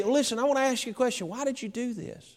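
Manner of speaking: soft, fast, gentle asking; polite quiet question. Retold or imitated. Preacher voicing a polite disciple, softly. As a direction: ask kindly, almost under the breath. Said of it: "listen to the tone of which they address this woman".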